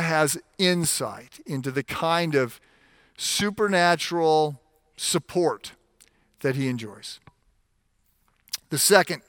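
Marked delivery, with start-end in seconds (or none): none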